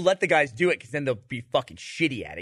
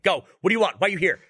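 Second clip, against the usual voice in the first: loud, barked commands, higher; drill sergeant; 7 semitones higher, 6 dB louder, faster than usual. Retold or imitated. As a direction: bark it like a drill instructor. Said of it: "like a drill instructor in the booth".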